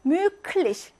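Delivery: 뮤클리시 with a voiceless "k" before "lich"; hardened k. Shown as a demonstration. Teacher demonstrates devoicing, 뮤클리시 with a hard k. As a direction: make the g a crisp k in 뮤클리시. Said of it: "크 소리가 난다고".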